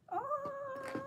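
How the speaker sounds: very high-pitched eagle screech; amateur imitation of an eagle; eagle cry. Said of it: "that was an eagle sound".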